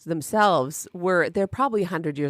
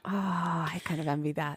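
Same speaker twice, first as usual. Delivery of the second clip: whispering; 7 dB quieter than usual. Real, not acted. Whispered envy.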